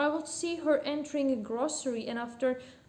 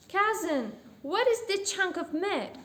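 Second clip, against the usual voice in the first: higher, commanding customer voice; demanding customer; 6 semitones higher, 4 dB louder than usual. A narrator's reading. Lady quizzes the butcher about meat, demanding. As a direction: raise the pitch for a customer questioning the butcher.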